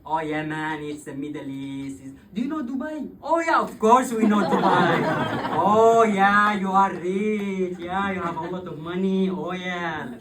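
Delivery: loud, high, mocking sing-song put-on voice; mocking the Germans. Imitating Germans who only know Dubai, mocking loud put-on voice.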